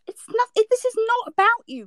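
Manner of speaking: real exasperation at being accused; exasperated outburst. Real, not acted. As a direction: burst out, cutting through the argument. Exasperated protest in heated argument.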